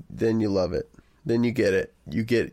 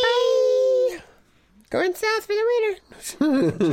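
tiny ladybug voice; much slower than usual. Pretend. Continues ladybug voice.

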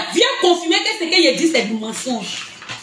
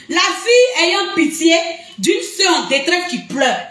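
ordinary talk, nothing marked

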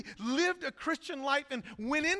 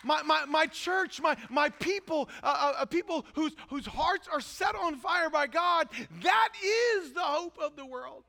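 impassioned preaching; 3 semitones higher, 3 dB louder than usual. Real, not acted. Pastor proclaiming the church as the world's hope, fervent preaching. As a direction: drive each phrase home, building to a cry.

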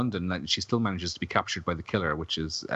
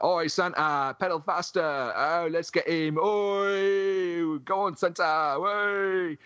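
Justin impression; 10 semitones higher, much slower than usual. Mimicking Justin as punks heckle a drunk Santa, high jeering shout. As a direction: yell it like a rowdy punk chasing Santa. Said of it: "my uncanny impression of Justin".

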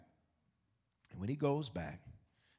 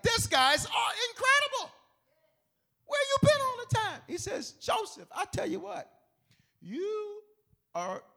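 much higher, louder king's voice; Pharaoh voice; 11 dB louder than usual. Retold or imitated. Pharaoh marvelling at Joseph, high and loud. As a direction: play the king, high and grand. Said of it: "he's like".

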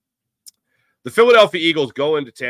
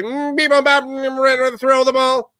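much higher beeping robot voice; 12 semitones higher than usual. Retold or imitated. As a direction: play the analytics nerds as a robot. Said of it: "the analytic department is like".

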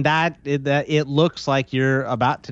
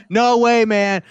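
higher, louder mock protest; defensive fan; 6 semitones higher, 3 dB louder, slower than usual. Playing the fake account sticking up for Bogman, mock outrage. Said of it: "he would be like".

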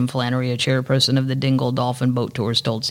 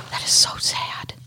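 whisper; 3 dB quieter, slower than usual. Real, not acted. Reacting to the sad news.

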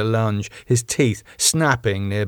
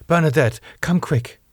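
higher, urgent summons; sharp beckoning; 7 semitones higher than usual. Acted. Rose summoning Bernadette to help, sharp beckoning. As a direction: beckon her over sharply. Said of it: "Rose beckoned sharply".

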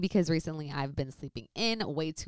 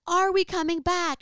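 high, loud shouted question; retold shout; calling out; 12 semitones higher, 5 dB louder than usual. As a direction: call across to the brother. Calling out across distance.

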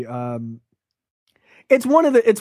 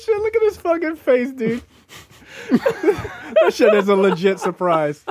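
slow, laughing through the words; can't hold back the laugh; 8 semitones higher than usual. Real, not acted. Reacting to the push-up scene, laughing slowly.

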